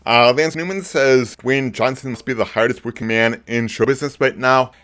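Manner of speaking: usual speaking voice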